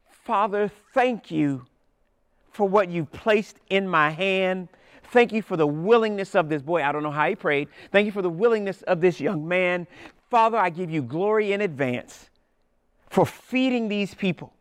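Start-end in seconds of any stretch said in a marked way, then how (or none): 0.1-6.8 earnest, raised prayer voice, slowed in places; a hint of a heartfelt blessing over the bread. 7.9-14.5 earnest, raised prayer voice, slowed in places; a hint of a heartfelt blessing over the bread.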